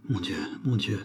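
murmured softly, trembling; murmure tremblant. A narrator's reading. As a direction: breathe it out, afraid to open it. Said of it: "murmura-t-elle". (murmuré tout bas, tremblante)

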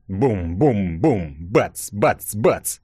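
slow, drawn booms then short bangs; imitated banging. A narrator's reading. Narrator voices muffled blows from the rubble, imitated banging. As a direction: sound out each thud separately.

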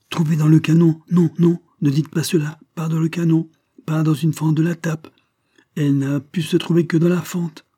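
cornered servant, frantic; panicked denial. A narrator's reading. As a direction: blurt it out in rising panic. Panicked denial about the cannon.

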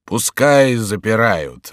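very slow, drawn-out dismissal; lazily slow falling reply; unconcerned monk. Acted. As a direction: stretch the words out, untroubled. Monk shrugs off the curfew.

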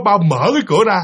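ordinary delivery, nothing marked

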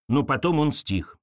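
usual speaking voice

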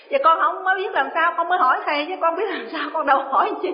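a laughing imitation of a panicked mother; flustered mock whine. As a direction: play the confused disciple pleading back. Disciple's flustered answer.

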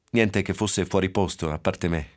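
plain reading voice

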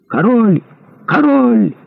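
high, drawn crowd cry; soldiers' high-pitched outcry; shouting. A narrator's reading. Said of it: "закричали несколько англичан".

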